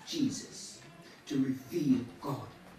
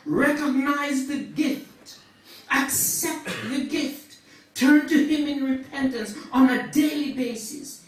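raised, higher sermon voice; fervent preaching; 9 semitones higher, 12 dB louder than usual. Acted. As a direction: preach it out with rising force. Preaches at full force.

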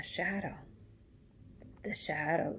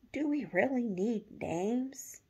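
soft gentle teddy bear; 5 semitones higher, 3 dB louder than usual. A narrator's reading. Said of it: "asked the teddy bear shadow".

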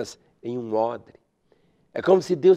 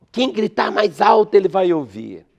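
mock shout; 5 semitones higher, 5 dB louder than usual. Mocking the idea of shouting.

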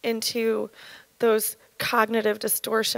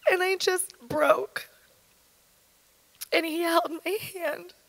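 breaking down; 5 semitones higher than usual. Real, not acted. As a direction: barely get the words out as you crack. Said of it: "I know that it has shaken".

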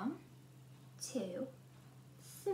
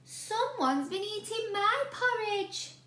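higher mother bear voice; rumbling Mummy Bear; 9 semitones higher, 4 dB louder than usual. A narrator's reading. Mummy Bear grumbling about her eaten porridge. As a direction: grumble like an upset mama bear. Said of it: "rumbled Mummy Bear".

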